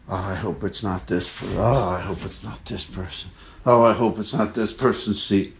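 dreading, muttered under the breath; hope against hope, softly; anxious inner voice. Retold or imitated. Plane passenger silently dreading the seatmate, soft murmur. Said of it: "half an eye just on that aisle".

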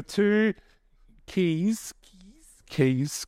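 usual speaking voice

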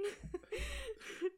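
a bright, high laugh spilling out; high burst of laughter. Real, not acted. Laughing at her own monkey scare story. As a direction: laugh high and hard at the memory.

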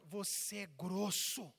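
slow, heavily drawn-out insults; acted anger. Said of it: "O que que as pessoas fazem quando tão com raiva?".

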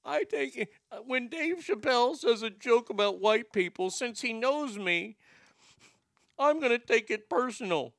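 mock a hurt, oversensitive complainer; easily offended whiner. Host mocking people who take jokes personally, whiny mock voice.

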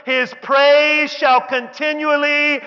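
high, loud, drawn-out praise; exultant shout. Acted. Preacher proclaiming David's last cave psalm, shouted slowly. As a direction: stretch each word into a ringing preacher's shout.

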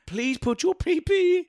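very high, slow, pleading comic voice; high-pitched silly begging. Pretend. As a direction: squeak out a mock plea, drawn out. Silly voice to derail co-host.

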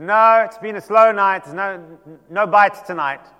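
loud reply across the water; calling back. Acted. Fishermen answer the man on the shore, calling loudly. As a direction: shout it back to the stranger.

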